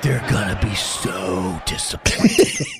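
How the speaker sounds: low, slow, sinking realization; low-pitched comic despair; dread. Retold or imitated. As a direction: draw it out with doom. Dreading the crowd's reaction.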